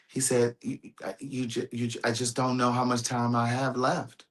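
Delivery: barely holding it together; choked up. Real, not acted. Quoting a crying friend to viewers, tearful and soft.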